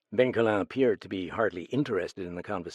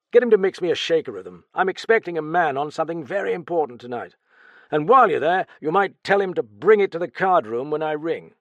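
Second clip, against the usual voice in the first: higher, louder, fast businesslike orders; hurried request; 7 semitones higher, 9 dB louder than usual. Acted. Raoul sending his friend to the bar, hurried. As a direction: rattle off the errand.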